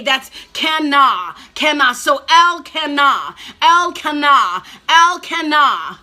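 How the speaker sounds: slow, deliberate "El Kana"; spelled-out teaching. Shown as a demonstration. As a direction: lay out "Kana" word by word. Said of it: "teaching the name Kana slowly".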